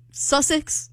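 high fake British; British accent. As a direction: answer in a badly faked British accent. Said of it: "Y'all have a British off right now".

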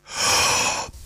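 sharp deep gasp in; breathing for stethoscope. Shown as a demonstration. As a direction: suck in a big breath. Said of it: "take a deep breath".

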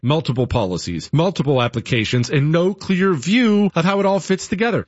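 upbeat live-read sponsor pitch; brisk radio ad voice. Acted. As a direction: sell it to the listeners. Host reads sponsor ad.

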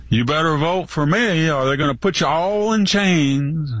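Biden. Retold or imitated. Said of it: "like Biden said".

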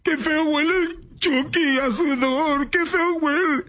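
high, drawn-out mocking girl voice; mock whiny girl. Host mocks a coworker complaining about sweat, mock girly whine. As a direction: whine it way up high, imitating a fussy little girl. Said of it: "Y tienes una niña".

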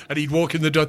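ordinary talk

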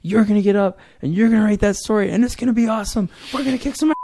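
high, loud, fast pumped-up cheer; rally him like a giant wrestler coach; hyped-up pep talk. Retold or imitated. Partner Greg pumping up the doubting writer, loud hype. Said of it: "And he's like".

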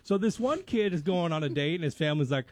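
ordinary talk, nothing marked

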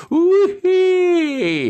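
very high, loud held "uhi"; high whooping howl. A narrator's reading. Dean whooping about the drunk friend and mambo, high howl. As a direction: shriek it out like a wild whoop.

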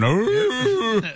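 high, drawn-out beagle howl; long, high mock howl; dog howl. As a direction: imitate a dog howling at the moon. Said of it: "they would howl, howl, howl at the moon".

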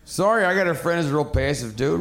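ordinary talk, nothing marked

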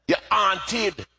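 put-on Jamaican accent. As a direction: scold a child in a Jamaican accent. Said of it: "in her Jamaican accent".

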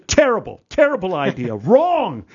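hammed-up director rejecting a pitch; director's verdict. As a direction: shoot the idea down with a laugh. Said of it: "He goes".

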